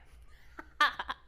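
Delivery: short breathy awkward laugh; nervous laugh. Real, not acted. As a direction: laugh nervously before starting. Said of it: "I always have to laugh awkwardly".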